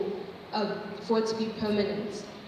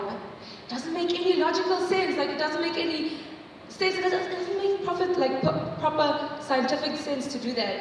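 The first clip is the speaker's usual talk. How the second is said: exasperated, pitched up, disbelieving; a mock-indignant objection; incredulous protest; 7 semitones higher than usual. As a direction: push back like it makes no sense. Arguing back at the teaching.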